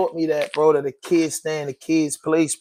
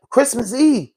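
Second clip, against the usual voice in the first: higher, caught off guard; stunned disbelief; 6 semitones higher than usual. Real, not acted. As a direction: say it like you can't believe it. Repeats the date in disbelief.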